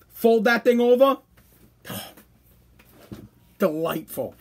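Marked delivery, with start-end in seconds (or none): 1.9-4.3 sigh, then a soft, low, savoring "delightful"; blissful sigh. Real, not acted. Host sighing over his favorite snack, soft and blissful. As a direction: melt into the word like the taste is perfect. Savoring the cream cheese sandwich.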